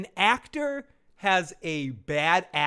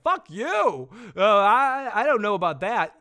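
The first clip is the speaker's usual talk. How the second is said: imitated angry king; 5 semitones higher, 5 dB louder than usual. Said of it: "And the king's like".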